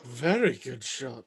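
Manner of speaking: low, slow, fading last words; weakly, with failing strength; dying words. Acted. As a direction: let it out slowly as you die. Butler dies after being shot.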